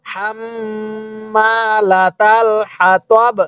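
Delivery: long hummed double m in হাম্মালাতাল; slow tajweed reading. Shown as a demonstration. Teacher showing how to read with a stop, long nasal hum in হাম্মালাতাল. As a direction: stretch হাম্মালাতাল on a held nasal m, stop crisply on হাতব. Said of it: "এরকম ভাবে পড়ব".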